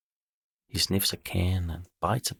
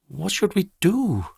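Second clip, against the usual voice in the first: high, louder panicked question; desperate cry; 12 semitones higher, 4 dB louder than usual. Acted. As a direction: cry out in despair. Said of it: "cries Jazz".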